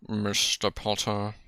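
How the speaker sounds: slow, drawn-out professor imitation; put-on teacher, measured and unhurried; mock stern professor. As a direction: deduct points slowly and deliberately. Mock teacher docking house points.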